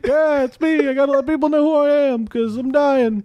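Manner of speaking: high-pitched, put-upon comic Jesus; self-important crucified Jesus. Acted. Dying Jesus explains himself to visitor, high-pitched and put-upon.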